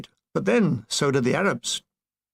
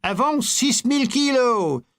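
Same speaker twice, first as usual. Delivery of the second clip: French gendarme; 7 semitones higher than usual. Acted.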